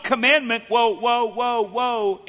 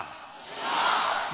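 group call; 9 dB quieter than usual. Shown as a demonstration. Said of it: "everybody say Shema".

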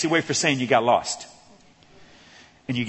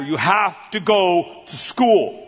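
ordinary talk

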